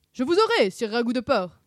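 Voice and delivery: high, loud chasing cry; playful shout. A narrator's reading. Sammy chases Scooby through the armour, shouting. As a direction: shout it as a knightly threat. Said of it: "cria Sammy en le poursuivant".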